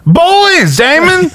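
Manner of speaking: loud, very high falsetto exclamation; mock outrage. Spelling out who the "others" are, mock outraged squeal.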